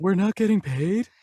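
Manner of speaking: mock surprise. Cohost pretending shock at no pay, mock surprise. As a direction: act stunned by the news.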